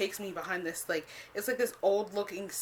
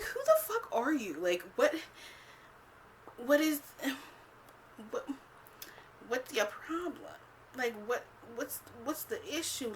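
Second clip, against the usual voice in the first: exasperated confrontation; 6 semitones higher than usual. Retold or imitated. Retold confrontation with the date.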